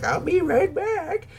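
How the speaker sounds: put-on high, slow little old lady. Imitating sweet old Rosie from the movie, high and slow.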